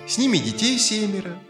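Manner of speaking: ordinary delivery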